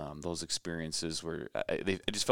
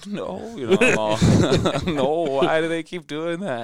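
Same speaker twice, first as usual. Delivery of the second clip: high, loud laughing confusion; a boy asking his coach why, half-laughing; younger self, higher; 11 semitones higher, 13 dB louder than usual. Retold or imitated. Teen player asking the coach, high and laughing. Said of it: "I'm like".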